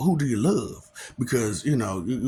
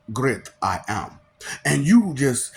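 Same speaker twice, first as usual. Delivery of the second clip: ordinary talk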